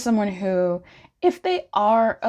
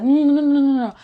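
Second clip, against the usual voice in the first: louder, higher quick string of no's; retold loud refusal; 7 semitones higher, 7 dB louder, faster than usual. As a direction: push the kiss away out loud, half laughing. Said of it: "was like".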